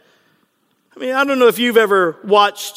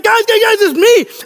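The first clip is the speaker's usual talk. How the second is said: high, loud excited pleading; over-eager mock excitement, louder and higher; all excited; 10 semitones higher, 5 dB louder than usual. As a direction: act out giddy insistence, pitched up. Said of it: "he's all excited".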